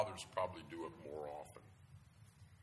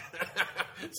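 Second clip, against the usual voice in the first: short laugh; 10 semitones higher, 5 dB louder than usual. Real, not acted.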